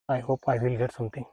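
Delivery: ordinary talk, nothing marked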